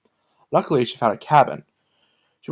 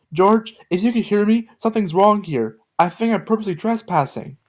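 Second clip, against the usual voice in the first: shouting; 9 semitones higher than usual. A narrator's reading. Joe calling out to George for help, high frightened shout. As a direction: call out for help, alarmed. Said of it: "Joe just shouted".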